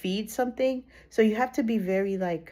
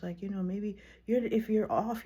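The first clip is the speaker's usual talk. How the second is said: ordinary talk, nothing marked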